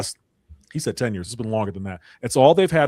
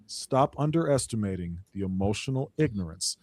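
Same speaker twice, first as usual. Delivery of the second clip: usual speaking voice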